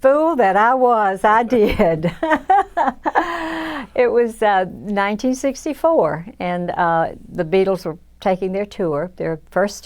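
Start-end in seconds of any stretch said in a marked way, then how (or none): 2.2-3.9 high rueful laughter; real laugh. Real, not acted. Laughing at her younger self to the interviewer, high. Laughs about turning down [name].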